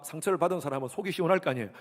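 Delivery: ordinary delivery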